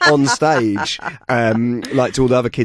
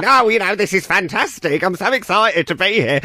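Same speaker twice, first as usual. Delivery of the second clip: higher, camp, excited voice; Alan Carr impression; 6 semitones higher than usual. Audition panel hears his Alan Carr, camp and excited. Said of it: "that Alan Carr going".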